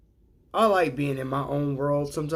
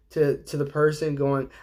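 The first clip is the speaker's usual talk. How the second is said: ordinary talk, nothing marked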